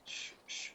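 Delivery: two soft shushes; shushing. Real, not acted. Host to caller who cut in with a question, shushing. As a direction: hush the interrupter with short shushes.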